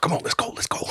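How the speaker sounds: whispered hurry-up, voice mostly unvoiced; hushed urging. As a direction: urge a friend on under your breath.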